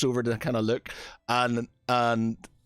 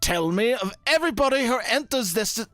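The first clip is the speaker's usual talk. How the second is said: higher, rising to a furious yell at fools; angry shout; 10 semitones higher than usual. Acted. High Paladin berating his monks for missing the visitor, angry shout. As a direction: scold the monks, building to a roar. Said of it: "he sort of shouts at one".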